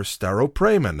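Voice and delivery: Staropramen as "starro-PRAY-men"; another trial pronunciation. Shown as a demonstration. Podcaster puzzling over his Czech lager's name, another trial pronunciation. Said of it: "How do you pronounce that?".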